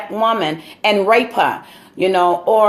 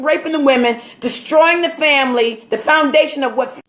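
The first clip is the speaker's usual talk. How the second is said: impassioned preaching; 6 semitones higher than usual. Acted. Speaker preaching to his listeners about slavery, fervent and rising. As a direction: build it like a sermon from the pulpit.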